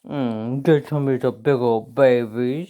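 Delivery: low, mushy pet voice. Real, not acted. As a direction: smoosh the words, cooing to a dog. Baby talk to her dog.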